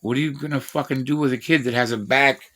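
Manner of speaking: normal talking voice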